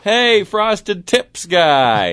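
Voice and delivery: high, drawn-out holler; calling out. Retold or imitated. Man hailing his drinking buddies at the pool, calling out. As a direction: holler it long and loud across a crowd.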